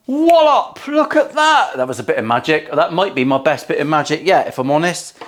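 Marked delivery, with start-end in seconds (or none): 0.1-1.8 high, triumphant exclamation; excited reveal. Pretend. Showing viewers the finished box after counting down, high excited cry. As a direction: cry it out like a magician's big reveal.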